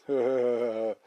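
short amused chuckle. A narrator's reading. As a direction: laugh it off lightly. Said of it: "Lacht Onkel Quatsch". (Onkel Quatsch lacht kurz)

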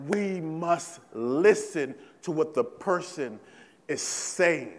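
ordinary delivery

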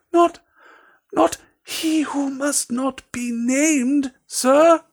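very high, slow, hesitant elf voice; slow squeaky house elf. A narrator's reading. Dobby hints at the danger to Harry, slow high elf squeak. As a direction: squeak each word out carefully.

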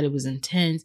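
normal talking voice

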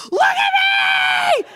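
put-on a girlfriend shouting at a partner with his eyes screwed shut; panicked demand. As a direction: yell it slowly, each word stretched. She demands he look.

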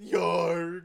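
loud pirate yarg; pirate growl. Pretend. Friends riffing a pirate name, loud pirate growl. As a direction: bark it like a cartoon pirate. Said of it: "He's a pirate".

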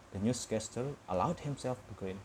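usual speaking voice

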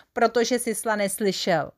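ordinary delivery

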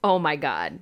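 ordinary talk

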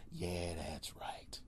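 slow, whispered yeah that's right. Real, not acted. Comic smugly whispers his boast to the audience. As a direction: hush it, smug and slow. Smug aside after bragging.

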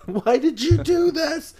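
high, slow hammed-up wail; mock parental anguish. Imagined parent pleading with the guilty son, mock anguished wail. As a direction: draw it out as a mock-distraught parent.

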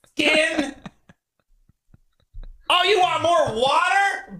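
high, prissy entitled whine; whiny spoiled pouty drawl. Retold or imitated. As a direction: sneer like a spoiled brat. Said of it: "a really prissy, entitled".

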